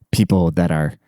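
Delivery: ordinary talk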